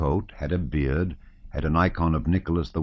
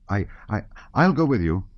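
eager cry; 5 semitones higher than usual. A narrator's reading. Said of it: "cried Petya".

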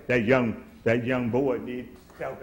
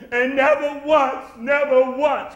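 crying out in grievance; 9 semitones higher, 5 dB louder, slower than usual. Retold or imitated. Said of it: "He just laying it out".

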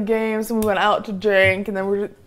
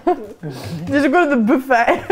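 laughing; slower than usual. Real, not acted. Teasing question while laughing.

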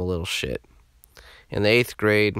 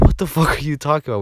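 quick, louder, higher incredulous reply; incredulous retort; 6 semitones higher, 5 dB louder, faster than usual. Retold or imitated. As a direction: fire it back in mock disbelief. Acted incredulous reply to friend's plan.

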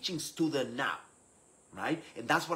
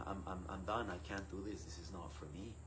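low, soft giving up; quiet defeat; 4 semitones lower, 11 dB quieter than usual. Retold or imitated. Struggling leader confessing to an Italian priest, quietly defeated. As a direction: admit defeat in a lowered, softer voice.

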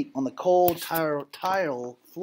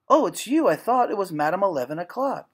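high and louder, startled relief; startled cry; 3 semitones higher, 3 dB louder than usual. Acted. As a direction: blurt it out, caught off guard. Girl jumps up, startled.

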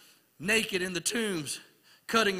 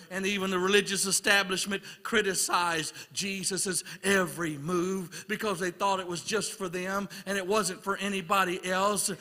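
ordinary talk, nothing marked

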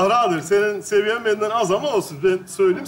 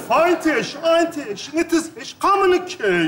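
higher, slow, insistent mock English; exasperated repeat; 4 semitones higher, slower than usual. Repeating for a baffled listener, exasperated and slow. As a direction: repeat it slowly with rising impatience. Annoyed repeat, not being understood.

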